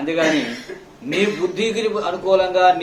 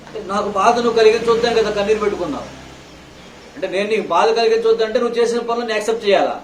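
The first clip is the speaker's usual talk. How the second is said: preaching at full force; 5 semitones higher than usual. Acted. Pastor rebuking the congregation for false tears, fiery preaching. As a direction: hammer each question at the congregation.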